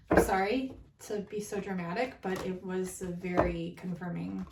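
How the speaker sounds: ordinary talk, nothing marked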